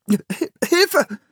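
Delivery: high, startled call for help; frightened cry. Acted. Philipp crying for help among the settlers. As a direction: yelp it in fright. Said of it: "rief Philipp erschrocken".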